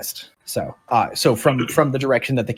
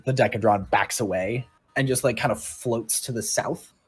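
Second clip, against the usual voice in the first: ordinary talk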